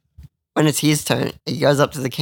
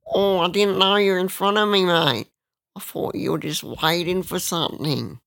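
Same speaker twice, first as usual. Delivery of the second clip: high, put-on crackhead whine; crackhead impression; 6 semitones higher than usual. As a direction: mimic a dopey excuse in a higher voice. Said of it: "So then he goes".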